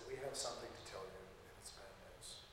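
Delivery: soft, grave, falling to near silence; quiet somber telling. Retold or imitated. Chaplain telling a soldier's wife, softly somber. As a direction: deliver bad news gently at the door. Breaking death news to wife.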